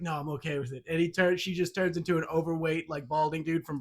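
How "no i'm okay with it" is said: low, soft reassurance. Retold or imitated. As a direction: say it softly, lower pitch. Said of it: "he's like".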